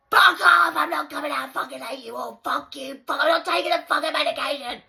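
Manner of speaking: shouted, high, raging through a closed door; yelling resident. Retold or imitated. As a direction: scream it out like a furious locked-in patient.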